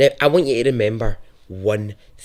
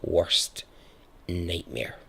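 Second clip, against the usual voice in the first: hushed true-crime narration; 3 semitones lower, 6 dB quieter than usual. Acted. Narrator to listeners, revealing the hidden bunker, hushed and ominous. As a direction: lean in and let the dread sink.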